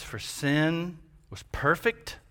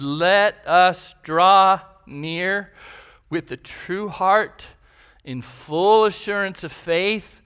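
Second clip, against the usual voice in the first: fervent scripture reading; 4 semitones higher, 5 dB louder than usual. Acted. Pastor reading Hebrews to the church, fervent proclaiming. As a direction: read it out with rising, louder fervor. Reads Hebrews passage aloud.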